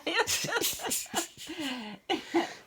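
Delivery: a high, giggling laugh; high giggle. Real, not acted. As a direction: giggle at your own joke. Child laughing at own mockery.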